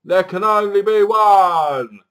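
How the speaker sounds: loud, slow, high heroic bellow; drawn-out, hammed-up war cry; mock battle shout. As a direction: shout it out like a film warrior. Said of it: "all I kept shouting out was".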